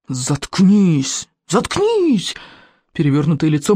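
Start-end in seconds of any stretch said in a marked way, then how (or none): 0.1-2.3 furious outburst. A narrator's reading. Molnienos silences taunting Kamikadze, furious and escalating. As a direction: explode on the repeat, pitch leaping far up.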